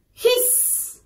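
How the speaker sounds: soft hiss with long trailing s; teacher's gentle snake hiss; snake sound. Shown as a demonstration. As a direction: stretch the s like a snake. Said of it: "How does a snake make sound".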